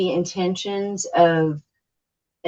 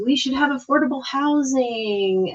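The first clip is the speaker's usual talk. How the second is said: higher voice quoting the public; weary imitation; 7 semitones higher than usual. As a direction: recite the predictable answer, pitched up. Voicing the predictable public answer.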